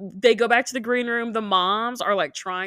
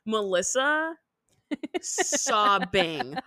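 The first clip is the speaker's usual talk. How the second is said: usual speaking voice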